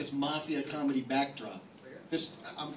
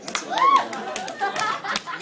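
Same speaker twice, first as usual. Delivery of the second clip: squeaky punchline; 7 dB louder, much slower than usual. Acted. Punchline on poster.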